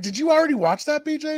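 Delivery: high, quick, faux-innocent ask; mock innocent question. A host mock-asking a guest if they watched. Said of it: "I was like".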